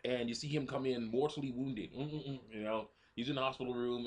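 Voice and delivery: ordinary talk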